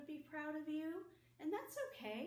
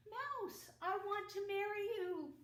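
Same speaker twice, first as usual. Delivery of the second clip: much higher and louder cat proposing; teacher voicing the cat to the mouse, high and loud; 9 semitones higher, 7 dB louder than usual. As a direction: squeal the proposal like a proud cat.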